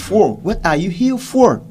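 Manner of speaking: "For" in full strong form, loud and high; slow question, final "for" stressed and fully voiced; loud, clear FOR. Shown as a demonstration. Teacher shows students the stressed word, loud clear FOR.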